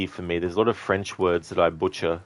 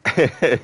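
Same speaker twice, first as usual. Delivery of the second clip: loud amused laugh; 7 semitones higher, 6 dB louder than usual. Real, not acted. Laughing after own joke.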